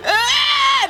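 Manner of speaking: high-pitched mock toddler wail; sudden put-on bawling; imitated child's cry. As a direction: imitate a crying kid, high and open. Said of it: "When he'll cry".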